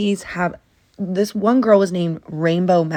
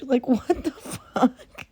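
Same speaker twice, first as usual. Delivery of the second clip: normal talking voice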